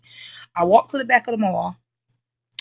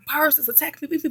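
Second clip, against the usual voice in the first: urgent explaining; 7 semitones higher, 3 dB quieter than usual. Retold or imitated. Telling dad on phone.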